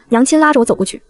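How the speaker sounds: ordinary delivery, nothing marked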